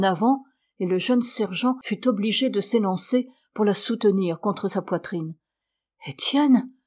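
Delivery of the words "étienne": mildly emotional cry of the name, pitched up; tearful recognition. A narrator's reading. Sister recognising her lost brother through tears, moved exclamation. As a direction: call the name out higher, moved. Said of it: "disait-elle en le contemplant à travers ses larmes".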